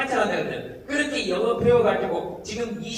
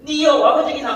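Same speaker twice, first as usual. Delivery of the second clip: loud, high-pitched Gyeongsang dialect; blunt Gyeongsang-dialect demo, pitched up; Gyeongsang accent; 6 semitones higher, 8 dB louder than usual. Shown as a demonstration. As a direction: punch out the question in Gyeongsang intonation. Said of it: "이게 완전히 영국식 영어".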